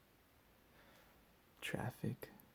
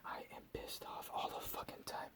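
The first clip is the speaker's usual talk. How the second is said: ordinary talk, nothing marked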